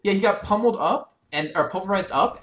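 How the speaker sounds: excited. Real, not acted. Eagerly describing the play.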